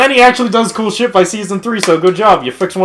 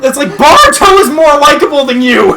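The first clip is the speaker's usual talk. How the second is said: laughing rant; 6 semitones higher, 5 dB louder than usual. Real, not acted.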